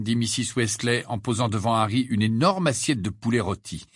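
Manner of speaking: usual speaking voice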